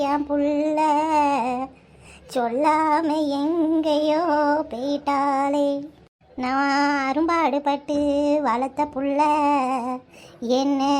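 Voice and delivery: low, slow mourning wail; wailing lament. Acted. Grandmother wails low and slow over her missing granddaughter. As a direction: drag each phrase out in grief.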